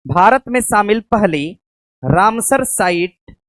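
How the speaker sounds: stretched-out dictation, word by word; slow it right down for writing; teacher dictating a note slowly. Shown as a demonstration. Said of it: "site को आप स्थल लिख सकते हैं".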